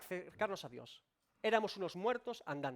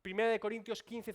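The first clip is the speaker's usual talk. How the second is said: ordinary delivery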